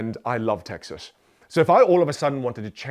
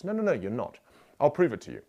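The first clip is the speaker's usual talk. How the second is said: ordinary talk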